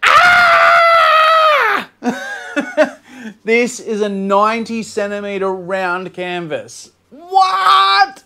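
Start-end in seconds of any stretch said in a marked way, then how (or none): 0.0-1.9 excited shout. Real, not acted. Excited at huge canvas. 7.1-8.2 surprised cry. Real, not acted. Mock disbelief at canvas size.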